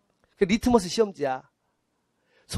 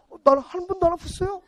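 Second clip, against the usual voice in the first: much higher, innocent-sounding voice; mock naive claim; 10 semitones higher than usual. Client claiming never to have been sick, high mocking naivety. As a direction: pipe up as someone absurdly healthy.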